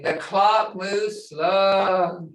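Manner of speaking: the clock moves slow, said very slowly; slow, drawn-out phrase. Shown as a demonstration. Teacher stretching the phrase out slowly for learners. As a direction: stretch every word out, unhurried. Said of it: "Othadi gomayes".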